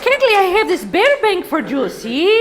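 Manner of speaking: put-on high, loud, gushing mother-in-law with a Spanish accent; excited mother-in-law. Mother-in-law congratulating her on the pregnancy, high and gushing. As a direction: squeal like a thrilled grandma-to-be. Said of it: "My wonderful, beautiful mother-in-law came over".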